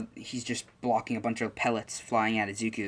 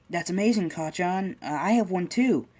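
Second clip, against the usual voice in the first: put-on much higher and louder, childlike excitement; excited little Izuku; 8 semitones higher, 3 dB louder than usual. Izuku tells Kacchan he has a quirk too, high and excited. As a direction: proudly announce his own quirk.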